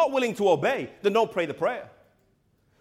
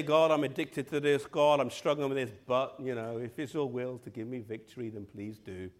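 fading, softer mumbled plea; half-hearted prayer; 7 semitones lower, 7 dB quieter than usual. Acted. Preacher mimicking a lukewarm believer praying, fading softer. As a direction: let the prayer trail off without conviction.

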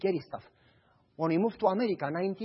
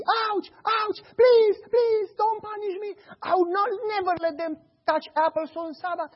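high, whimpering fake cries; fake crying boy; 13 semitones higher than usual. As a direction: plead like a boy being beaten. Boy faking cries under beating.

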